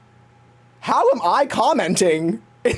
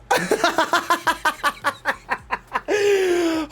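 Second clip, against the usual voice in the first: giggle, higher; high laugh; 10 semitones higher than usual. Real, not acted. Reacting to the episode, high giggle.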